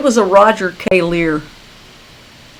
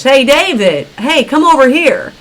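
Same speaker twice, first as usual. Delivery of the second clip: higher-pitched hail, only mildly raised; raised-voice call; 5 semitones higher than usual. Shown as a demonstration. Host hailing David from across the street, raised voice. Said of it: "I'm gonna raise my voice".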